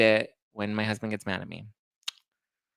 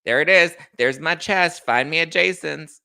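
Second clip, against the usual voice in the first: loud, full-chested declaration; whole chest; 5 semitones higher, 7 dB louder than usual. Real, not acted. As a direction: say it boldly from the chest. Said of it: "Say it with your whole chest".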